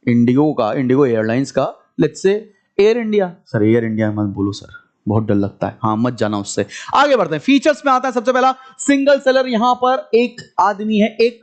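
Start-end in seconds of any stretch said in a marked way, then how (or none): 3.5-6.7 very low, subdued student voice; mock scared student. Student begs teacher not to mention Air India, mock fearful. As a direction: put on a low-voiced, timid pleading student.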